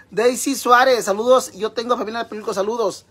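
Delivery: loud, high shout-out; saludo a voces. Real, not acted. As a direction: call out a greeting to a follower. Saluda a gritos a seguidora. (saludo gritado y agudo)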